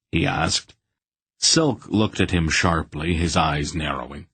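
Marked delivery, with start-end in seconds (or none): none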